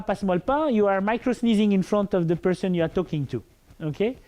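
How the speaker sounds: normal talking voice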